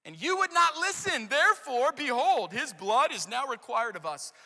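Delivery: loud, rising accusation; reproach. Acted. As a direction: let the blame land hard. Reuben's reproach read dramatically.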